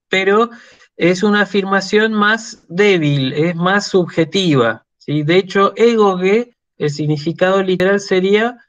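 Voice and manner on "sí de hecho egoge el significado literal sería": ordinary delivery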